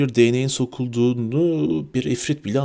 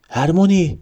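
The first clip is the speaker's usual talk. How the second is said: higher, louder shout of her name; alarmed cry; 6 semitones higher, 5 dB louder than usual. A narrator's reading. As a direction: call out in sudden alarm.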